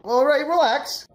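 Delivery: high, slow acted plea; stretched, high-pitched appeasing; panicky placating. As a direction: play backing off from a big guy. Frightened golfer placates big guy.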